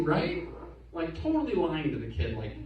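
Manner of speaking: normal talking voice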